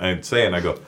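ordinary delivery

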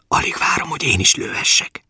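put-on eager child's whisper. A narrator's reading. Boy longs to shoot too, whispering to his father. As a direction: whisper it like a secret wish. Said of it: "súgta a gyerek".

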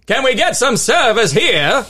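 put-on slow, high Maine accent, Dunkin' customer. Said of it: "my Maine accent".

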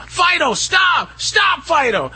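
high-pitched mock scream at a dog; imitated panicked screaming. Trainer mimics owners screaming at an out-of-control dog, high mock shout. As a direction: draw out a high, frantic yell, acting it out. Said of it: "they start screaming".

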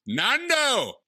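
high hammed-up call of a name; mock greeting. Greeting their colleague Nando with a high hammed-up shout. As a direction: call out to a friend in a big put-on voice.

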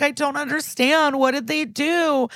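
high-pitched whiny fake cry; hammed-up crying voice; mock crying. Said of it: "[name] is crying".

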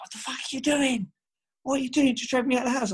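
high-pitched outraged brother; brother's outrage. Retold or imitated. Brother yelling at the one who dragged him out. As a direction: shriek it in shocked disbelief.

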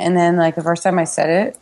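usual speaking voice

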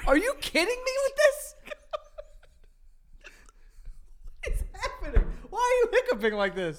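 high, loud laughing disbelief. Real, not acted. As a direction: laugh through the words. Laughing at friend's hiccups.

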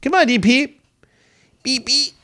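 high, playful call to a cat; coaxing pet call. Real, not acted. Owner calls his approaching cat, high pet talk.

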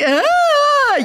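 high loud dramatic cry; mock wail. Mom crying at a movie, hammed-up high wail. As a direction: ham up a sobbing wail. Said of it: "Ya hago mi drama".

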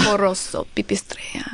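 put-on breathy Russian-accented whisper of the name. Guest whispering the new nickname in a Russian accent. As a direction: hush it out in a spooky Russian accent. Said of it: "I don't know why I did a Russian accent".

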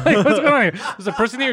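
high, loud, half-laughing disbelief; retold startled outburst. Host retelling finding a girl on the couch, loud mock shock.

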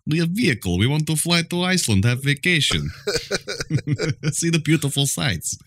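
high, broken-English Nordic tourist voice; mock Scandinavian accent. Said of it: "That was a weird accent".

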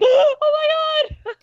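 gasped, high and drawn-out; gasping in mock shock, stretched out high. Acts out own theater reaction.

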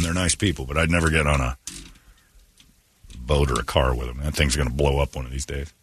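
ordinary talk, nothing marked

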